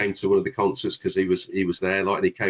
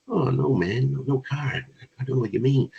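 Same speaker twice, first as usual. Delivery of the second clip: higher-pitched mock American; pretend American accent; 4 semitones higher than usual. American night staff turning away the band, in a pretend American accent. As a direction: put on a light American accent. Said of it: "me pretending American accent".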